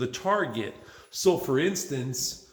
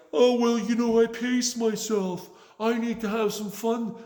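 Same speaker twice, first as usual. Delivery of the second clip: mocking lazy people; 8 semitones higher than usual.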